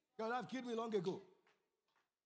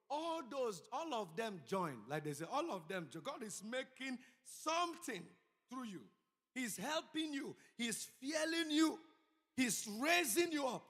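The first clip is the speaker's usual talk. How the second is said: slow, raised voice building to loud; emphatic preaching; 5 semitones higher, 5 dB louder, slower than usual. Real, not acted. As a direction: build phrase by phrase to a loud, high declaration. Preaches at full force.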